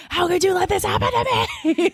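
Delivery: a hammed-up cry at the sky; cursing out God. Girl with ruined hair, railing at God in mock despair. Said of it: "I'm, like, cursing out God".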